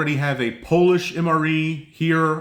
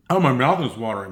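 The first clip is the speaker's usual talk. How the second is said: ordinary talk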